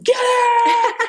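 very high, strained cheering scream; yelling. Retold or imitated. Best buddy demonstrated at the roadside, yelling. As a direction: scream it out to fire them up. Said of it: "and he's yelling".

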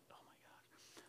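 breathy whispered aside. Retold or imitated. Imitating son Ronnie rolling his eyes, whispered. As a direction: whisper it like a saint suffering.

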